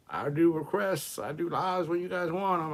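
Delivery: sing-song fake servitude; sarcastic. Sarcastic about doing requests.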